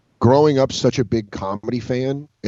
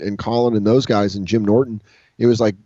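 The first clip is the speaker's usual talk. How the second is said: ordinary talk, nothing marked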